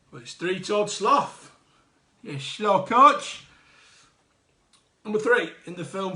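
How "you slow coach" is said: slow, drawn-out mock taunt; louder, slow teasing jibe. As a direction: stretch it out to tease the slow one. Teasing over the sloth answer.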